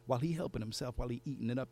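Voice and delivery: ordinary talk, nothing marked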